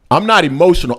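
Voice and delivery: ordinary delivery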